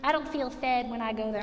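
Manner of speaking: lightly voiced grumbling member; mild mock complaint, dropped low; mock churchgoer complaint. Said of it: "I said".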